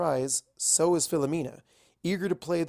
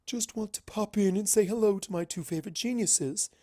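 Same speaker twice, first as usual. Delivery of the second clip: higher, bright princess greeting; lightly voiced cheery princess; Luna, breezy; 7 semitones higher than usual. A narrator's reading. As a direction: breeze in sweetly, a bit too cheerful. Narrator voices Luna arriving.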